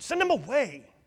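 loud, high demand; crying out. A narrator's reading. Savages urging brother to send firstborn away, loud. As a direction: shout to get rid of him. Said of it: "they cried".

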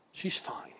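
whispered gently; soft whisper. Retold or imitated. Telling the old man about Hannah, whispered. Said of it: "I said softly".

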